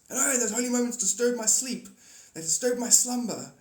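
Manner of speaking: loud, high mock indignation; playacted irate complaint; grumpy Holy Spirit. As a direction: lecture whoever disturbed your sleep. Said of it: "I'm the Holy Spirit".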